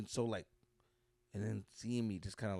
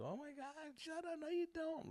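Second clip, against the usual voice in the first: put-on high, squealy girl's denial; playful high-pitched crush reply; mock flustered girl; 8 dB quieter than usual. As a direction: squeal it like a giddy fifth-grade girl.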